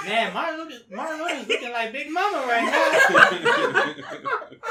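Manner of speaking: high, loud, laughing delivery. Real, not acted. Friend repeating a joke to co-hosts, laughing loudly. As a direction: crack up while telling the joke.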